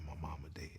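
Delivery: barely voiced whisper, grief held in. Real, not acted. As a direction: breathe the words with no voice. First time admitting mother's death.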